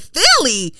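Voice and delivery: high, loud, drawn-out incredulity; retold disbelief. Reacting to being taken for a Philly girl, incredulous. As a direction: exclaim it in comic outrage, stretching it out.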